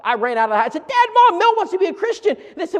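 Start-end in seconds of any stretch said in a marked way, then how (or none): 0.9-2.4 much higher and louder, bursting with news; excited boy. Retold or imitated. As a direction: call out the good news to your parents. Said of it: "I was so excited".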